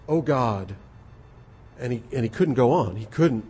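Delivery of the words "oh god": ordinary talk, nothing marked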